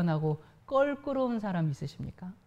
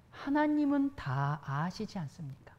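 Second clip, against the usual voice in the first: usual speaking voice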